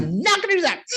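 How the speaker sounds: high, loud mock refusal; childish defiance. Nun sticking her tongue out at the swami, acted defiance. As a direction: refuse like a squabbling sibling. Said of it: "ever stuck my tongue".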